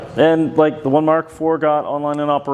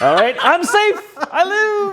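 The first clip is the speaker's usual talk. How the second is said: high, laughing cheer of relief. Real, not acted. Robot survives the roll.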